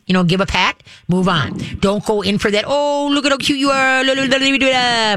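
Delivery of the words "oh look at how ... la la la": put-on high, sing-song baby talk to a puppy. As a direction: gush over the dog like a baby. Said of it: "Don't do that".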